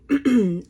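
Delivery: quick, loud throat clear. Real, not acted. Host pausing mid-introduction, loud throat clear.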